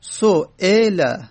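سئل drawn out slowly, each sound held; slow demo of su'ila. Shown as a demonstration. Teacher to students, slowly drawing out سئل. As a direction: say سئل slowly so the class hears the hamza.

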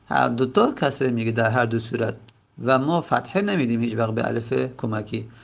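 ordinary delivery

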